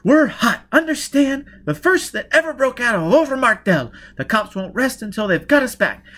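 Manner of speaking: loud, high-pitched urgent tirade; heated, insistent near-shout; exasperated. Acted. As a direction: drive the danger home, at the end of patience. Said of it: "exclaimed in exasperation".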